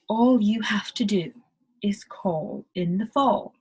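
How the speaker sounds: "call" and "fall" with rounded O-like vowels, whispered ending; British accent, end on a whisper. Shown as a demonstration. Teacher demos British vowels to learners. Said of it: "all of those A's are sounding like O".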